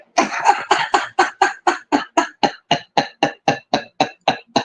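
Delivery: silent wheezing laugh; real breathless laughter. Real, not acted. Laughing hard.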